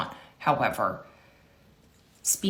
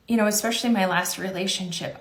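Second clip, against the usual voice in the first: usual speaking voice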